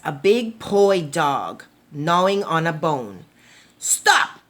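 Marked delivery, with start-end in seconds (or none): 3.8-4.4 high, sharp barked stop. Acted. Poi dog chasing the musubi man, sharp bark. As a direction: bark the word out. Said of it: "barked the poi dog".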